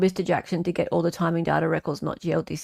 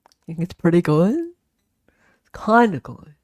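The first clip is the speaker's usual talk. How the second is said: Ashley Jennings voice; 4 dB louder than usual. Retold or imitated. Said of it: "I've tried to do Ashley Jennings' voice".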